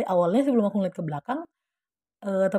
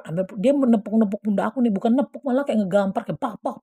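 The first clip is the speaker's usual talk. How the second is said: usual speaking voice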